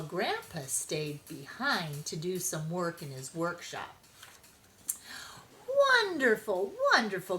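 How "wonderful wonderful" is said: louder, lilting happy grandma; grandma delighted by the berries; singsong delight. A narrator's reading. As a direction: sing out the praise. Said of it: "Grandma sang".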